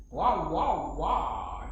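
playful repeated wows; three drawn-out, hammed-up wows; mock amazement. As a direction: put on amazement at the next problem. Mock amazement at next problem.

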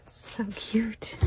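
soft delighted whisper; hushed cooing. Real, not acted. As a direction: whisper it like a secret. Cooing over a gift.

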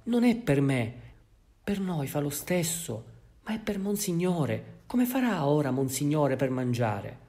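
high, loud anxious lament; fretting housekeeper. Acted. Housekeeper frets to the bishop about the silver, loud lament. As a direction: wail it like a worried old servant.

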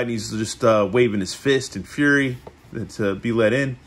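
usual speaking voice

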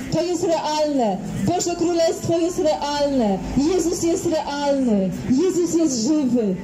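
normal talking voice

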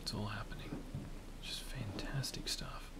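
soft, dry whisper. Real, not acted. Gamer grumbling to himself about voicemail mid-game, whispering. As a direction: mutter it under your breath, whispering. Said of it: "Why am I whispering so much".

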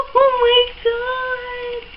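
very high, drawn-out squeal of delight; real giddy, high-pitched thrill; overjoyed squeal. Real, not acted. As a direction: laugh into a slow high squeal. Overwhelmed by gift's blush palette.

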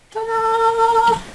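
high triumphant ta-da; ta-da reveal. Real, not acted. Showing viewers the finished shelf, high triumphant ta-da.

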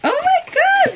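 high, gushing over a gift; excited squeal. Real, not acted. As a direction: squeal with delight at a present. Opening mom's gift.

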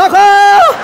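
loud yell rising at the end; yelled cry for help. Retold or imitated. Client screaming at each wax pull, yelled cry for help. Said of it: "era um grito que eu dava".

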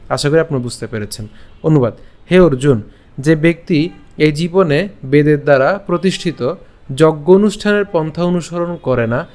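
ordinary delivery

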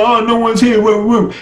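Beta searching the room. Retold or imitated. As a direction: play the villain finding an empty room.

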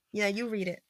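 plain reading voice

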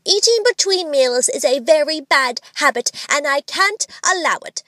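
high, firm scolding mother; mother's tone. A narrator's reading. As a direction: lay down the rule like a mother. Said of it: "in the tone her mother often used to her".

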